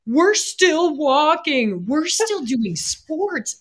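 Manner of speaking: slow, high-pitched emphatic boast; mock amazement. Two back patients marvelling they still function, mock amazement. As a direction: draw each phrase out, high and incredulous. Acting out their shared boast.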